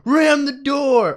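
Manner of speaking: high, loud, drawn-out command; shouted order. Retold or imitated. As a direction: bellow it like an urgent order. Acting Raph ordering the driver.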